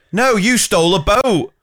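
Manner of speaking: put-on high, eager child correcting a classmate; mock child voice. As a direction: chirp it like an excited kid. Children answering teacher in assembly.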